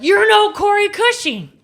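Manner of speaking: high, drawn-out, loud mock retort; retold outburst. Wife retelling her comeback to Clayton, loud retort. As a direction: throw it back loudly, pitched right up.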